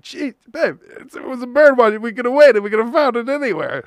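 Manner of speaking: high, loud exasperated whine; a hammed-up high whine at babe; mock exasperation. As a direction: whine it at your girlfriend. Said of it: "And I'm like".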